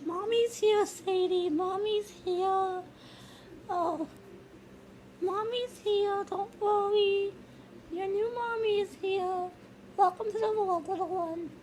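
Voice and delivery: high, soft mommy voice; soothing a child. Acted. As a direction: reassure the newborn tenderly. Mother comforts the baby.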